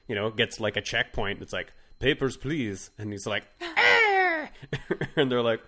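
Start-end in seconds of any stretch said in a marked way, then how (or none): 2.0-2.9 put-on Russian-accented checkpoint guard; bad Russian accent. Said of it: "That's my terrible Russian accent". 3.6-4.5 loud, very high bird screech; hawk screech. Acted. Hawk answers the checkpoint guard.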